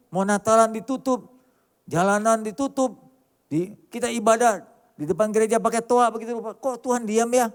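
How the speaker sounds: rising fervent sermon lament; impassioned preaching. Acted. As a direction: pound each line from the pulpit. Preaching at full force, building.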